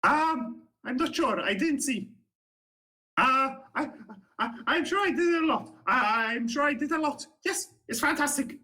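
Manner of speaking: high, cheerful bluster covering he saw nothing; bluffing halfling. Acted. Halfling guard to the player, bluffing in a high voice. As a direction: pitch it up and bluff brightly.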